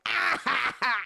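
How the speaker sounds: loud put-on cackle; imitating loud old ladies laughing; mock loud laughing. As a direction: ham up a loud fake laugh. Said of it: "like, loud laughing".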